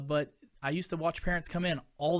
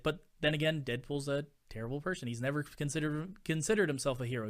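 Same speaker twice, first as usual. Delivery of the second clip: normal talking voice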